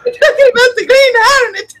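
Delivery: hammed-up excited parroting; excited mimicry. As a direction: imitate an overexcited radio actor. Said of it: "He's so excited".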